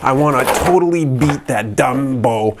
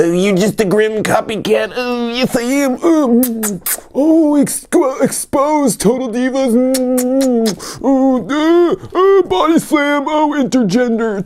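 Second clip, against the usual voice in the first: squeaky skit character. Pretend.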